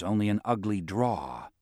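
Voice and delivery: ordinary delivery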